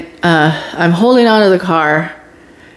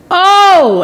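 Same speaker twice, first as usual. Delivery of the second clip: high, loud pained 'oh'; imitated cry of pain; 11 semitones higher, 5 dB louder than usual. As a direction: yelp as if it hurts to sit. Acting pain on sitting down.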